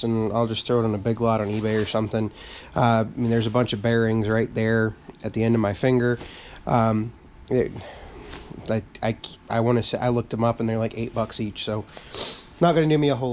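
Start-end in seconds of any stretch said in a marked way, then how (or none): none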